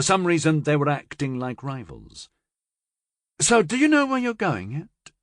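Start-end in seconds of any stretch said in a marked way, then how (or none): 3.4-5.1 teasing woman's voice. A narrator's reading. Laura asking Florian.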